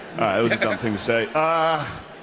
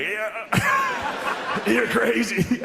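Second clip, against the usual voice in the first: laughing mock greeting; 12 semitones higher, much slower than usual. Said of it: "I would've been like".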